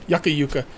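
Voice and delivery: yucca as YUCK-uh, then yucca as YOO-kuh; both pronunciations. Shown as a demonstration. Player covering both camps, yucca as YUCK-uh then YOO-kuh. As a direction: say yucca both ways back to back. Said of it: "I'll just say both".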